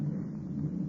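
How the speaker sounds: a soft, voiceless laugh; bitter breathy laugh. Acted. Victor to Waldman, bitter hollow laugh. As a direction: laugh at your own ruin.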